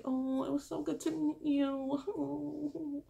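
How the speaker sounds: slow, high, drawn-out fake sweetness; mock polite. Rejected contestant bidding the lead goodbye, mock-polite coo. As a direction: stretch out a syrupy high goodbye. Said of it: "doing the little polite thing".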